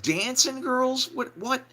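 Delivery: high, stretched-out disbelief; incredulous echo. Retold or imitated. Band hearing of dancing girls, incredulous echo. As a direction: repeat the news in high-pitched amazement. Band reacts to astonishing news.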